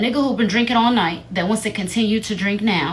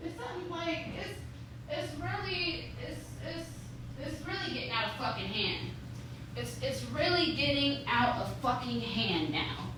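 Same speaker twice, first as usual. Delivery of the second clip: very soft, high-pitched complaint; small, high, despairing voice; hushed exasperation; 7 semitones higher, 15 dB quieter than usual. Real, not acted. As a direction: lament it faintly, far under normal volume. Drained aside after the outburst.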